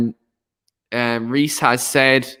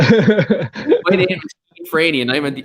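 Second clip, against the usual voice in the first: Paul Heyman; 2 semitones higher than usual. Acted. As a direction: introduce yourself as a wrestling advocate, half laughing. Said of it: "Keane is our Paul Heyman".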